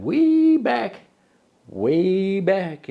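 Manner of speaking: slow, drawn-out once-upon-a-time; slow storyteller. Acted. Host starting the history like a fairy tale, slow. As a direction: stretch it like an old tale.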